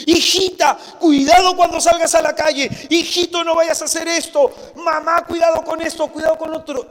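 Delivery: high, loud warning shout; overprotective parent. Retold or imitated. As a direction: call after the child, full of alarm. (madre sobreprotectora gritando advertencias)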